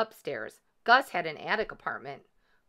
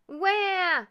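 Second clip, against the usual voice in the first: put-on high, loud baby yell; baby's wail; 12 semitones higher, 5 dB louder than usual. A narrator's reading. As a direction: wail like a baby wanting more. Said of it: "yelled baby ghost".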